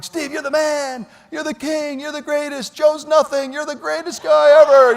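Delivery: mock crowd yell building to a roar; crowd cheering. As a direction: imitate fans hollering his name. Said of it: "people were yelling".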